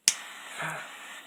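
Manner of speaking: loud breathy sigh of frustration; heavy exasperated sigh. Real, not acted.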